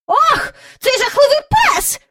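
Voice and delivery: high, loud fussy lady appalled; disgusted old lady. A narrator's reading. Miss Lark appalled at Andrew's scruffy companion, high and loud. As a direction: recoil at the mongrel. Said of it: "промовила міс Джайворон".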